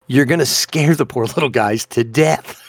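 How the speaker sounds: ordinary delivery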